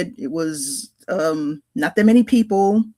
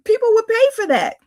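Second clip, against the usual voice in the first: much higher, amazed pushing; incredulous plea; 13 semitones higher than usual. Retold or imitated. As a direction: urge him in disbelief. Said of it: "It's like".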